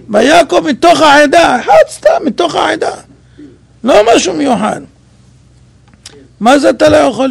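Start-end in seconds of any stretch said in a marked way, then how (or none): 0.1-4.8 emphatic declaration. Acted. Stressing an ordinary man.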